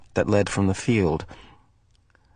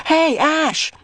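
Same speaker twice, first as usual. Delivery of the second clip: high shout across the field; shouting to friend in play area; 3 dB louder than usual. Acted. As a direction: call out to find a friend. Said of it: "he shouted".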